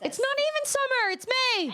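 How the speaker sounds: indignant. Real, not acted. Complaining about Texas heat.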